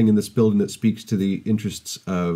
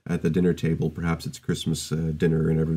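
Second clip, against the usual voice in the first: ordinary talk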